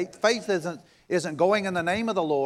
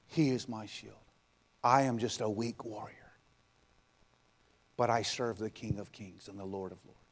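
low, soft, slow confession of faith; quiet preaching; 7 semitones lower, 6 dB quieter than usual. Acted. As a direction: draw them in softly. Quiet closing declaration.

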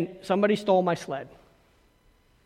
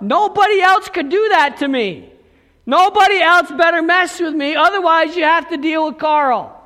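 emphatic preaching; 6 semitones higher, 5 dB louder than usual. Real, not acted. Preacher building to a point.

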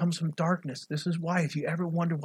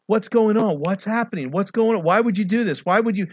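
rapid-fire questions in a raised, higher voice; confused clamour; 4 semitones higher, 5 dB louder than usual. Retold or imitated. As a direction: act out a bewildered crowd, loud and insistent. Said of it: "imagine the mass confusion".

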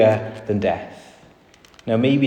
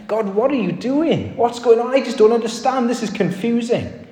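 much higher, anguished questioning cry; perplexed plea to God; 13 semitones higher than usual. Acted. Prophet pleading with God over the dead son, high and anguished. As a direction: voice the bewildered prophet crying out. Preacher voices Elijah's anguished prayer.

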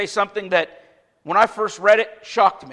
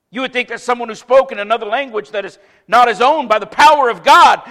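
louder preaching push; fervent sermon delivery; 2 semitones higher, 5 dB louder than usual. Acted. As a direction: drive the point home to the congregation. Preaching at full force.

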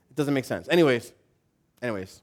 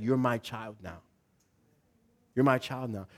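soft and tender; tender voice of God; 4 semitones lower, 8 dB quieter than usual. Retold or imitated. God welcoming a new believer home, soft and tender. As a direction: gently claim them as your own. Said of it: "He's like".

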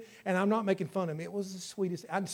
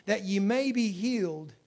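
scripture reading; 4 dB louder than usual. Acted. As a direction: read the verse like a preacher. Reads scripture aloud.

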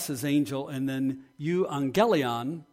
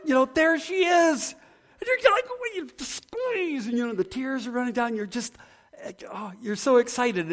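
overjoyed reunion; 9 semitones higher than usual. Pretend. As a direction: gush like you've just hugged her after years. Emotional reunion retold.

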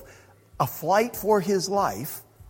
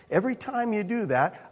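ordinary talk, nothing marked

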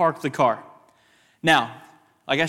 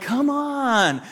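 high, drawn-out call; 9 semitones higher than usual. Retold or imitated. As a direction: urge a dawdling friend from afar. Group calls impatiently to friend.

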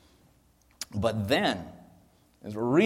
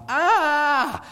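high, loud wailing "aah"; long, piercing cry of alarm; demon's scream; 6 dB louder than usual. Acted. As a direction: shriek like a panicked demon. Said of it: "it's kinda like the demon is going".

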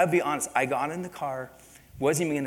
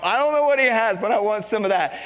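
quoted factory workers; 8 semitones higher, 9 dB louder than usual. Retold or imitated. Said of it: "you know".

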